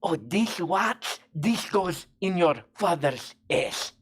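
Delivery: usual speaking voice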